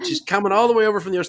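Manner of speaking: a rushed, fast attempt at an Australian accent; a very bad Australian accent, done quickly. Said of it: "That's my worst Australian accent ever".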